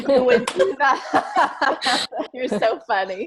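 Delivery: laughing through the words; slow, breaking into laughter. Real, not acted. Teasing promise to ugly cry.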